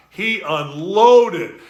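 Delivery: "unloaded" drawn out slowly and hit hard; slow emphatic. Real, not acted. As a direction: lean slowly on "unloaded" to drive it home.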